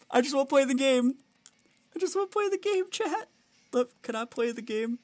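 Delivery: high-pitched pouty whine; squeaky, self-pitying complaint; whiny pleading. Real, not acted. Whining to chat after bad luck.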